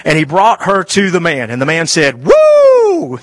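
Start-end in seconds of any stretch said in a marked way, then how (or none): 2.2-3.2 a high, loud whoop of delight; excited whoop. Acted. Preacher voicing Adam seeing Eve, whooping high and loud. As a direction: hoot like a man thrilled at the sight. Adam's exaggerated reaction to Eve.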